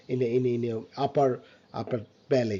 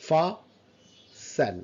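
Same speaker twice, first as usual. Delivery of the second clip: FASTEN split as "fah... sen"; syllable by syllable. Shown as a demonstration. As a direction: stretch fasten out, pausing between syllables. Teacher breaks word into syllables. (fasten ভেঙে ধীরে "ফা... সেন")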